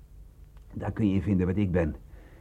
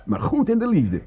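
boastful; 13 semitones higher, 7 dB louder than usual. Acted. Boasting about love, loud high.

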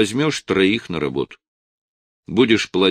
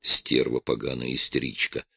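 inner thought; 7 semitones lower, 7 dB quieter than usual. A narrator's reading. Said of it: "думал я".

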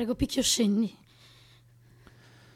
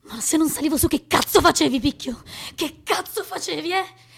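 crying and shouting, louder and higher; tearful angry yelling; 8 semitones higher, 10 dB louder than usual. Acted. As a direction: scream at him through tears. Sara crying, yelling at him.

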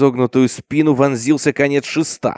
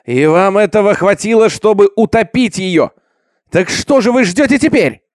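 raised, higher angry shout; shouting in outrage; 6 semitones higher, 5 dB louder than usual. A narrator's reading. Jack rebukes the people who tried to drown her, shouting. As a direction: shout it at the crowd, building higher. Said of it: "закричал Джек Хайслоп".

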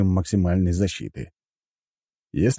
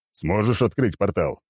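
frowning commander. A narrator's reading. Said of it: "нахмурился Черномор".

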